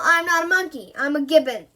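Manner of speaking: loud, offended correction; indignant protest. Acted. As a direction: object loudly to the insult. Gibbon protests being called monkey.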